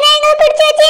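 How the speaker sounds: a high, earnest plea. Acted. Wife swears to husband ghosts held her, high plea. Wife insists it's true.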